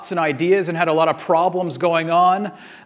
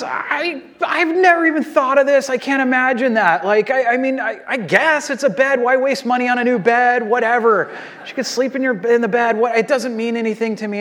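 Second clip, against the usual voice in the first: flustered husband; 7 semitones higher than usual. Retold or imitated. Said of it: "He goes".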